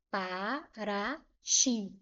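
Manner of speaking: "para ti" slowly in three separate syllables; split into syllables. Shown as a demonstration. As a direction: break "para ti" apart syllable by syllable. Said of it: "Aí eu tenho aqui". ("para ti" separado em sílabas, devagar)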